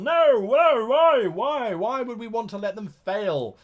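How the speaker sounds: high, loud, squealing "No, no, why, why"; mock outrage. A game master horrified that players might be left to fail. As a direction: protest like it's unthinkable.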